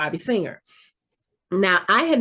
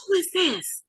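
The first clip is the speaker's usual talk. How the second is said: high, softer mock-baffled question; puzzled kids; 10 semitones higher, 5 dB quieter than usual. Kids on the phone to an unknown caller, imitated puzzlement.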